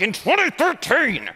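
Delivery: slow, emphatic big reveal; dramatic presenter flourish. Shown as a demonstration. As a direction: announce the year with a flourish. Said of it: "I usually have the pointer, and I'm like".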